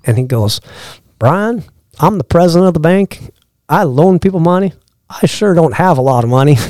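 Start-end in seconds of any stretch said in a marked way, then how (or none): 1.2-6.6 slow Georgia drawl, pitched up; Southern drawl. Retold or imitated. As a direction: imitate the Atlanta banker's Southern drawl. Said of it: "he's got the drawl".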